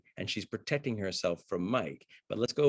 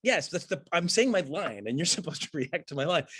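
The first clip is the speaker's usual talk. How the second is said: exasperated actor, much higher; frustrated actor spelling out the rehearsal, pitched up; imitating the actor; 8 semitones higher, 3 dB louder than usual. Actor scolds his co-star about reacting to lines, high and exasperated. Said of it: "and he's like".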